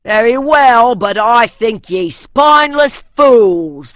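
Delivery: a northerner scorning his comrades, loud; northern accent scorn. A narrator's reading. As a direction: spit out the insult in a northern accent. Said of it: "the northern man replied".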